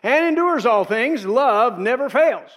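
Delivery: slow, loud, climbing scripture climax; preacher driving the verse home; preaching at full force. Acted. Preacher to congregation, slow loud climax.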